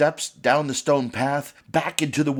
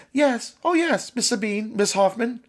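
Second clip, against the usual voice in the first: put-on chiming children's chorus; 10 semitones higher than usual. A narrator's reading. Children chiming to the witch in high sing-song. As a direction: chime in together like eerie children. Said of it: "The chorus chimed in".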